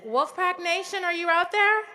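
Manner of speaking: loud high call to a stadium; calling out to the crowd. Real, not acted. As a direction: shout it out to the whole crowd. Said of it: "Let's try that again".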